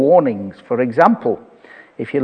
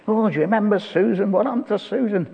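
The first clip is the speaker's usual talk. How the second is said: lightly voiced gossip, higher, quick; mock reunion chatter, higher and fast; chatty old classmate; 6 semitones higher, 5 dB quieter, faster than usual. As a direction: play an eager old schoolfriend asking. Acted chat among old classmates.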